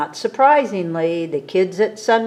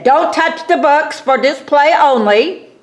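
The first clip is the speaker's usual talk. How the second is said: louder and higher, like a big warning sign; booming sign voice; 8 semitones higher, 8 dB louder than usual. Acted. Narrator booms out the library sign's warning, louder and higher. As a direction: boom out the sign's command. Sign read out over reader.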